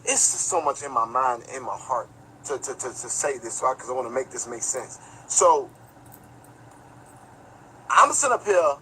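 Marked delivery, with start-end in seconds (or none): 0.1-5.0 choked up. Real, not acted. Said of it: "Let me calm myself down".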